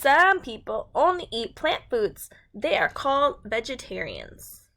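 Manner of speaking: bright, clear storybook read-aloud; reading to children. A narrator's reading. Teacher reads a food book to her class, storybook voice.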